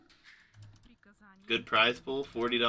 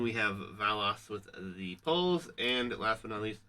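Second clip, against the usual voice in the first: normal talking voice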